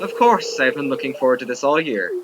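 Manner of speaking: mild hammed-up dignitary; lightly voiced governor. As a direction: gush politely about the soup. Reads the governor's line.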